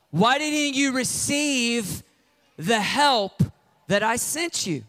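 incredulous Jesus. Retold or imitated. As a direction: lay out each rescue, exasperated.

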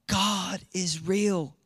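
slow, breathless, gasping in real awe. Real, not acted. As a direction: let the truth hit you and gasp it out.

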